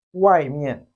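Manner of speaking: loud, careful wai mian; Chinese wai mian. Shown as a demonstration. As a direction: model wai mian for copying. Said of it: "Repeat after me".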